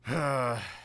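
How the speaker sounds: long audible exhale, falling away; weary sigh. Acted. Captain asked if they can help, sighing. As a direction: let out a heavy sigh.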